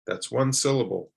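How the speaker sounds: ordinary talk, nothing marked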